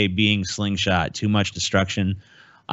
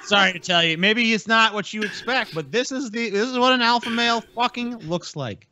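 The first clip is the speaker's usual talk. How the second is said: sarcastic; 10 semitones higher than usual. Pretend. Mocking the man in the video.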